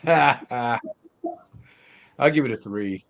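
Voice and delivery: ordinary talk, nothing marked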